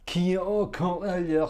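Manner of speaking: labored French read-aloud that falls apart. Real, not acted. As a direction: stumble through French lyrics, losing the rhythm. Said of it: "I can't do it in rhythm".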